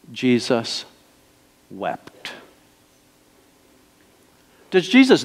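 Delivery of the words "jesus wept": hushed reading. Acted. Preacher reads shortest verse.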